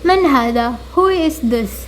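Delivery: slow, careful question with falling end; slow teacher reading; slow model question. Shown as a demonstration. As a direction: ask it slowly as a model. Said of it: "okay".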